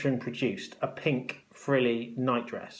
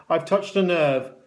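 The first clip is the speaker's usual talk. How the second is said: higher, louder taunting matron; mocking; 4 semitones higher, 6 dB louder than usual. A narrator's reading. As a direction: needle the upset boy. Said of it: "she added mockingly".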